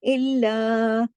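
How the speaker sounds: इल्ला said very slowly with the doubled ल held; held doubled lam. Shown as a demonstration. Teacher shows the doubled lam in इल्ला to students. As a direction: stretch इल्ला, pressing the doubled ल. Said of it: "देखिए तशदीद है".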